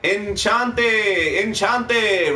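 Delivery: stretched-out, clumsy fake French greeting; badly faked French accent. Rapper showing off French greetings to a friend, bad French accent. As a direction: attempt French and get the accent badly wrong. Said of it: "That was the worst accent ever".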